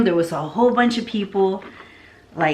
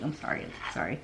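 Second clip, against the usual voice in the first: meek apology; 5 semitones lower, 13 dB quieter, faster than usual. Retold or imitated. Retold apologizing meekly.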